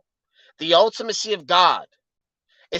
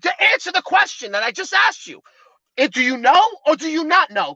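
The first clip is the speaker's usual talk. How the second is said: sharp, pressing, higher pitch; heated; 6 semitones higher than usual. Real, not acted. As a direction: demand an answer, getting heated. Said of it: "are you getting heated".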